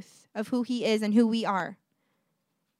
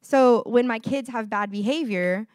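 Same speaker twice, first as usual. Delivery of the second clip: usual speaking voice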